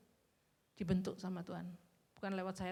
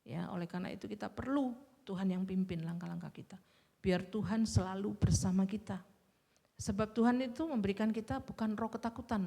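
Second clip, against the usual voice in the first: ordinary talk, nothing marked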